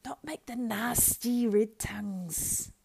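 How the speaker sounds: slow, loud hissing creature whisper; hissed. A narrator's reading. Gollum warns Sam against the fire, hissing. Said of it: "hissed Gollum".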